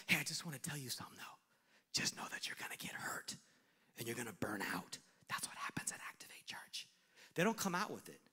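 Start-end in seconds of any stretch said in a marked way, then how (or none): 0.1-5.0 hushed, breathy secret warning; conspiratorial whisper. Pretend. A gossip confiding doubts to a volunteer in a whisper. 5.3-6.9 whispered aside. Real, not acted.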